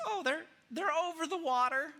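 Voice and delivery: high, put-on lady's voice; airy high-pitched mock female voice; woman covering up. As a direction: play innocent in a high falsetto. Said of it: "And she says".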